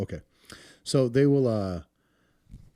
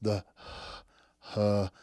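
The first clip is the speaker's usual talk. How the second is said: soft, breathy huh sounds; the breathy 500 hertz sound in a breath; breathy huh demo; 3 semitones lower, 4 dB quieter, much slower than usual. Shown as a demonstration. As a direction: push out a small breathy huh. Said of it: "It's that sound in a person's breath".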